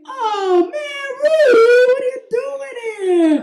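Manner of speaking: very high, drawn-out group wail; hammed-up whiny dismay; exasperated discovery. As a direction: groan out the name in loud disbelief. Everyone discovers it is the uncle.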